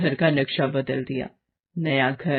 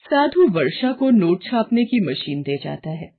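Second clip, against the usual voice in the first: plain reading voice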